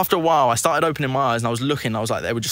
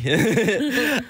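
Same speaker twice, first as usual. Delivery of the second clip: real laugh; 11 semitones higher than usual. Real, not acted. Laughs at own story.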